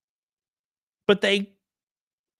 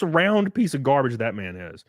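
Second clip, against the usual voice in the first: normal talking voice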